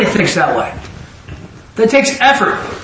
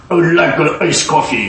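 light mock Russian accent; imitated Russian accent. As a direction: retell a playful attempt at a Russian accent. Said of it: "Use a Russian accent".

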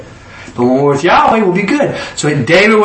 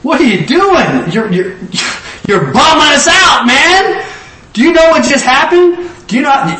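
mock scolding; 10 semitones higher than usual. Joab scolding grieving David.

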